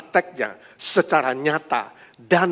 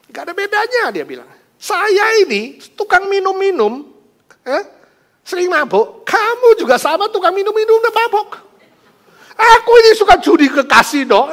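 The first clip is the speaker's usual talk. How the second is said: much higher, loud mocking tirade; husband mocking his wife, loud and scornful; scornful rant; 14 dB louder than usual. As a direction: rub her nose in it. Husband mocking wife's hypocrisy.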